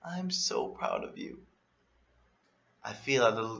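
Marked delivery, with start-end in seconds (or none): 0.0-1.4 tearful pride. Retold or imitated. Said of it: "she tearfully says".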